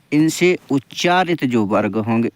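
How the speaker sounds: ordinary talk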